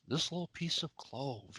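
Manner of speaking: put-on soft, lightly sketched German accent. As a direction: imitate a sarcastic German villain, quietly. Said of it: "in his little German accent".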